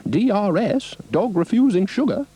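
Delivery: high, fussy initials; high Ermintrude quip; prim cow. A narrator's reading. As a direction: announce it like a gossip.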